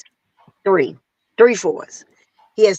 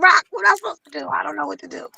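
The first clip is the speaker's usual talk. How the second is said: panicked plea; 9 semitones higher, much faster than usual. Retold or imitated. Panicked call to friend iRock.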